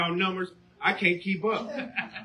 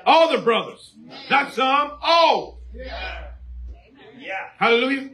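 rising preaching shout; 5 semitones higher than usual. Real, not acted. Preacher building emphasis.

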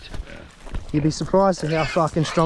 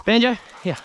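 calling a lost pet; 7 semitones higher than usual. Real, not acted. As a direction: call out for the dog. Calling the dog.

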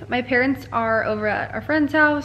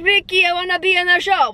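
Lucy's line in a mock Cuban accent, higher and louder; 8 semitones higher, 6 dB louder than usual. Redoing her Lucy quote in a Cuban accent. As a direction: whine to Ricky with a Cuban accent. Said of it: "I gave her a Cuban accent".

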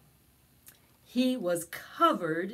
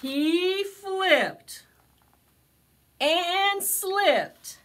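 higher, louder excited narration; 11 semitones higher, 7 dB louder, much slower than usual. A narrator's reading. Reading to children, excited about the tumble. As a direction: build the tumble with glee.

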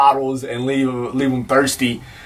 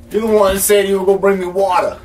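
higher, quicker reminder of a promise; insistent repeat; 6 semitones higher, faster than usual. Acted. Thirsty man reminds visitor about promised water, insistent. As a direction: press the point, pitched up and hurried.

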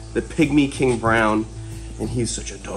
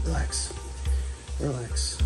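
soothing; 11 dB quieter, slower than usual. Real, not acted. Calming the snake.

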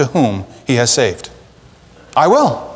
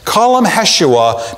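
ordinary delivery

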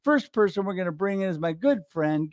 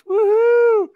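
high, loud "woohoo" cheer; celebratory whoop; 7 dB louder than usual. Real, not acted. Host introduces the queen of video, cheering whoop. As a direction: whoop to welcome the next guest.

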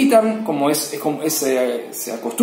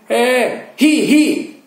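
mock congregation calling out; 8 semitones higher, much slower than usual. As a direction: call out the fix like the whole congregation. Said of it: "la comunidad empieza a decir".

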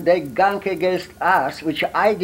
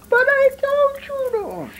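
scolding grandmother. Retold or imitated. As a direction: squeak it out like a fussing grandma. Grandmother refusing in retold story.

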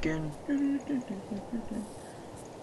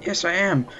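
ordinary talk, nothing marked

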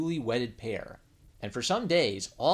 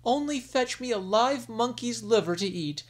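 higher, coaxing request; queen wheedling her husband; pleading queen; 11 semitones higher, 3 dB louder than usual. Acted. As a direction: ask sweetly for the liver. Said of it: "the sick queen said".

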